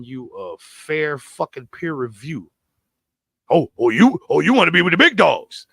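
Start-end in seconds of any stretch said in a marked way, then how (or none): 3.5-5.7 louder mock taunt, sneering at him. Teasing the actor about the big dogs, loud mocking taunt. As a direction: taunt him like he's out of his league. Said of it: "That's kinda what he was doing to him".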